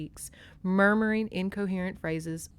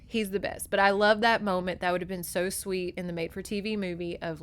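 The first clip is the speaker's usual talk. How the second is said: ordinary delivery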